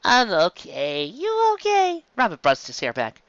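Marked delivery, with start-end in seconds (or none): 0.0-2.0 high, slow, dopey giggling; loopy under sedation, giggly and high; high sing-song drugged voice; drugged giggly Luffy. Acted. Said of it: "Luffy turned to her and giggled".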